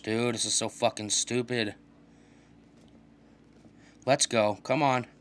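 usual speaking voice

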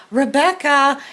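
high call of a name from far off; calling across a lot. Retold or imitated. Someone from her past calling her name across a parking lot. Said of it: "my name being shouted from across the parking lot".

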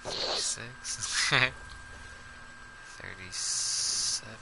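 counting softly under breath; quiet counting. Real, not acted. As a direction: mutter the count to yourself.

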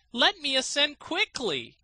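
high, loud, eager; eager cry. A narrator's reading. Student begs to climb in, high and loud. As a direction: urge him on impatiently. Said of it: "cried he".

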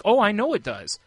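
sarcastic agreement. Retold or imitated. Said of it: "I'm like".

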